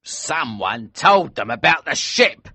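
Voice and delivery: high, loud, slow furious outburst; angry cry. A narrator's reading. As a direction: cry out in rage. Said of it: "cried Elsevier angrily".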